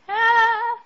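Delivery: abrupt high yelp of frustration; startled high ah; loud sudden ah. Real, not acted. Sudden exclaim.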